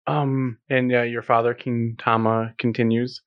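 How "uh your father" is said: usual speaking voice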